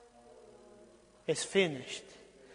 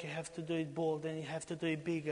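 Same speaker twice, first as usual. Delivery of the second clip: usual speaking voice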